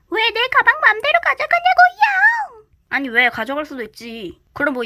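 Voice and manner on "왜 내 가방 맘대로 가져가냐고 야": shrill, high, furious yelling; angry shout. Acted. Cat yells at big sister for taking her bag. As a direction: scold her like you've been robbed. Cat angrily confronts thief.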